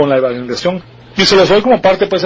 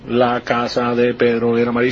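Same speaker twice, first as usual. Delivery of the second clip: words separated; 5 semitones lower, 3 dB quieter than usual. Shown as a demonstration. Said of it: "no había separación".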